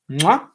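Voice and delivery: loud smacking air kiss; kiss sound. Real, not acted. Dad blowing his baby a kiss, loud smack.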